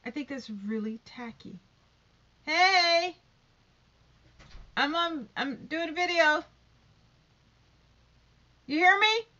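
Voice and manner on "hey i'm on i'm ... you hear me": loud high yell to someone in the next room; loud shout at someone interrupting the video; shouting offscreen. Real, not acted. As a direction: call out loudly to make them hear.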